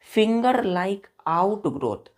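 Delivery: drawn-out, word by word; slow dictation. Shown as a demonstration. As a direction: let each word hang so the students can copy it. Dictating key term slowly.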